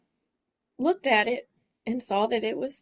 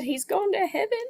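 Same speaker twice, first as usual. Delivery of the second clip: crying; 10 semitones higher than usual. Real, not acted. Daughter retells mother's devastating news.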